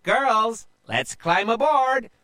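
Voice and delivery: rally her women to board; pirate captain yell. A narrator's reading. Pirate captain yelling at her crew, high. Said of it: "a woman pirate captain yelled".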